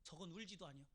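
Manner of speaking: low, hushed disapproving aside; villagers' gossip. Acted. Villagers at the funeral gossiping, low and hushed. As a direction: murmur the reproach behind someone's back. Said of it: "동네 사람들".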